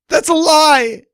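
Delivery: screamed at full force, high and raw; rage-fuelled shriek; furious scream. Acted. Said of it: "I screamed".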